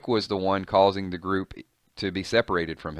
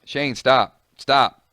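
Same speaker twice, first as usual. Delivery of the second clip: calling out; 4 semitones higher, 6 dB louder, much slower than usual. Acted. Carl calling after his dad.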